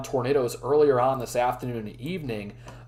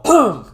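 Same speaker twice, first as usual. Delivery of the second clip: loud sharp throat clear; 7 semitones higher, 11 dB louder than usual. Real, not acted. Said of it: "I'm losing my voice".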